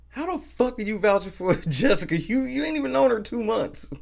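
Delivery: high, incredulous and laughing; can't believe what he's hearing; laughing disbelief. Real, not acted.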